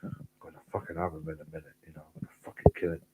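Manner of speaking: rushed and under the breath, imitating a seething coworker; muttered threat. Kitchen porter muttering about the chef, fast and hushed. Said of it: "He's going".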